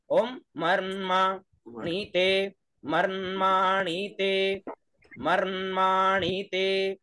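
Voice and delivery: मर्माणिते spelled out slowly and carefully; careful Sanskrit pronunciation of मर्माणिते; clear recitation demo. Shown as a demonstration. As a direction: teach मर्माणिते syllable by syllable. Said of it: "तो क्या उच्चारण हुआ?".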